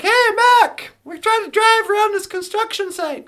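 loud, high-pitched hail over the noise; calling out. Acted. Riders hailing a jackhammer operator at a construction site, calling out. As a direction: shout to get the worker's attention.

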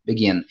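"begin" stressed on the second syllable, be-GIN; correct stress. Shown as a demonstration. Teacher correcting the student, "begin" stressed on GIN. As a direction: land "begin" on GIN, first syllable light. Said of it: "Só a sílaba tônica".

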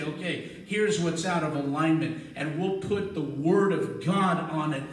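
loud, rising sermon delivery that swells toward a shout; a pastor pumping faith into a hurting crowd, loud and rising; full-force preaching. Acted. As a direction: preach it hard to the congregation.

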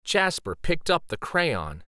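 usual speaking voice

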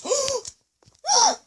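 sharp high gasp; shocked gasp. Real, not acted. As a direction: gasp in sudden surprise. Sees rare item.

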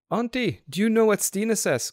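put-on high, eager little girl's voice; small child crying out. A narrator's reading. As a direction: ask it like an excited small child, pitched high. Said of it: "cried the small, lively, brown-eyed creature".

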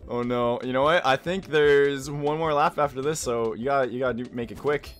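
normal talking voice

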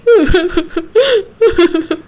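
broken, choked sobs; sobbing. Acted. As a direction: cry helplessly at the wedding. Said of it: "அழாதவ". (அழுகை, விம்மல்)

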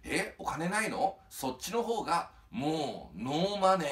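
slow start, then a loud high ノーマネー; punchline. Acted. 芸人がおじさんに返す決め台詞、ノーマネーを高く大きく. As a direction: land the pun with a big finish.

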